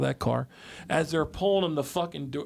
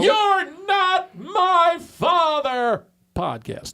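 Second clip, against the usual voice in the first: slow, drawn-out higher title call; show title announce; 11 semitones higher, 4 dB louder, much slower than usual. Pretend. Co-host announcing the podcast title to listeners. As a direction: stretch the show name like an announcer.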